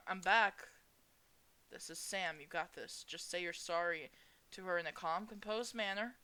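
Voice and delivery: ordinary talk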